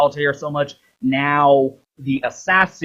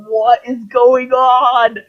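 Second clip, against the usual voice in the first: high, slow, bewildered squeak; baffled Ezio; 9 semitones higher, 4 dB louder, slower than usual. Retold or imitated. Ezio squeaking in confusion at the talking hologram, high and slow. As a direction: squeak out the confusion like a lost hero. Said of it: "there going".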